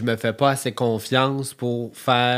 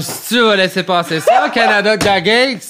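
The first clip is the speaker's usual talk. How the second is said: loud playful indignant shout; mock outrage; 8 semitones higher, 8 dB louder than usual.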